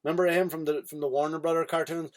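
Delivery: usual speaking voice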